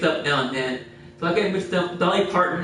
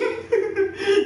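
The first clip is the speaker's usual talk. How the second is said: high laugh. Real, not acted. Cracking up mid-story.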